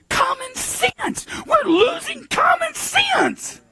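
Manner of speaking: high, slow mocking singsong; mock high drawn-out voice. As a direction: stretch common sense out in a high mock voice. Mocking the phrase common sense.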